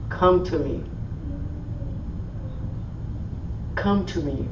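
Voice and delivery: gentle inner voice. Acted. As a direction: call them in gently, like a voice in the heart. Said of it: "you're gonna hear this voice saying to you".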